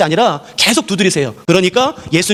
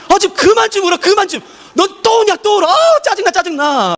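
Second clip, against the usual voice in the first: annoyed complaint; 11 semitones higher than usual. Acted. Churchgoer complains at a member who always cries, much higher and fed up. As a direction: snap at someone who keeps crying. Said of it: "이런 말 하지 않으세요".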